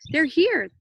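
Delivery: put-on high, loud kid yelling they're here; little boy's thrilled high shout; excited scream. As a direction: shout it like a boy spotting rescue. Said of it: "the little boy screamed".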